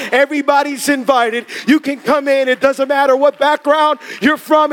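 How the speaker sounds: ordinary talk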